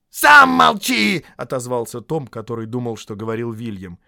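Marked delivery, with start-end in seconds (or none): 0.1-1.2 very high, drawn-out yell; furious retort. A narrator's reading. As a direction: yell it right back, stretching it. Troll quarrel, retort.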